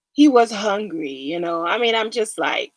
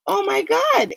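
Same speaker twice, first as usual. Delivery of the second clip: scared gasp; 12 semitones higher than usual. Retold or imitated. Man panicking at the ghosts.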